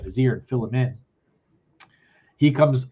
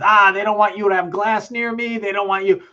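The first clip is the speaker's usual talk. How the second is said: high, louder mock rant of the singer; 10 semitones higher, 4 dB louder than usual. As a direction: ham up the angry frontman, high-pitched. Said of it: "first thing out of [name]'s mouth is".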